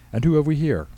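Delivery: high, loud, beaming welcome; cheery greeting. Acted. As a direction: greet them like a delighted host. Pastor warmly greets young couple.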